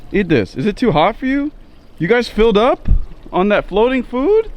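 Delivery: high excited voice for the fish; pet talk. Real, not acted.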